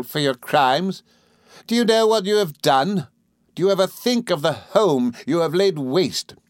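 high, indignant reproach; Rosa Dartle, accusing. A narrator's reading. Rosa reproaching Emily about home, indignant. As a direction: accuse in swooping, emphatic questions.

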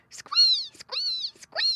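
three piercingly high squeals; piglet squeals. A narrator's reading. Hungry little pigs answer mother's call, squealing. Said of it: "squealed all the little pigs".

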